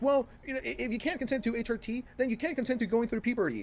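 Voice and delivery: high, quick mock whine; mocking imitation. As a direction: parody their argument in a high voice. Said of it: "They're like".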